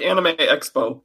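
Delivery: ordinary talk, nothing marked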